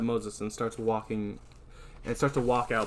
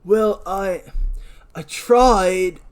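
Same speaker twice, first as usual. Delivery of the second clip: higher, louder, halting excuse; flustered defense; 6 semitones higher, 7 dB louder, much slower than usual. Acted. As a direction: protest with a stammered start. Howard defends himself to warden.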